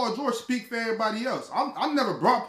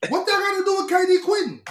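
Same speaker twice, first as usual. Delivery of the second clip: incredulous challenge; 9 semitones higher than usual. Real, not acted. Calling out friends' debating tactics, high incredulous challenge. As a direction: voice jumps high in exasperated disbelief. Mocking how the others debate.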